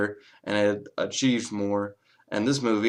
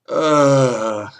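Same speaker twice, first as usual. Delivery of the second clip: a loud, higher groan of disgust; 4 semitones higher, 8 dB louder than usual. Real, not acted. Reviewer groaning at overdone Southern accents, disgusted. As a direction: let out a fed-up ugh.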